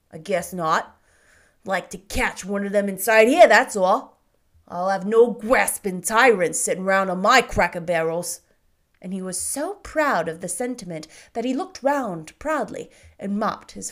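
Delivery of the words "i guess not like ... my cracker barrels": put-on loud, gruff and indignant old man; indignant shopkeeper. A narrator's reading. Shopkeeper answers the boy about earls, loudly indignant. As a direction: bristle with scorn at the very idea. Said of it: "answered Mister Hobbs with indignation".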